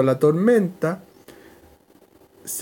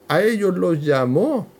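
ordinary delivery

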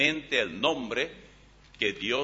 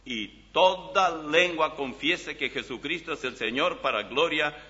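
scripture reading; 4 semitones higher, 5 dB louder than usual. Acted. Reads Philippians aloud from Bible.

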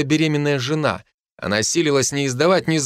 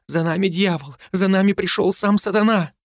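rising frightened plea, voice climbing higher; panicked cry; 6 semitones higher than usual. A narrator's reading. Wife warns husband in panic, voice climbing. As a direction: terror mounting as she shouts the devil is near. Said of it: "забормотала женщина в панике".

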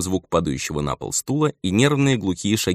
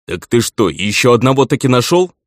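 loud, raised cutting-in question; interrupting; 6 semitones higher, 7 dB louder than usual. A narrator's reading. Elder cuts off old man about a new labourer, interrupting. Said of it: "перебил старичка Гарлан".

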